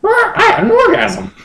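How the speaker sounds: very high, breathy-free squeal of a Pokemon bird; high Moltres voice. Acted. Moltres sighing to Justin in a very high voice. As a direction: pitch way up as the legendary bird.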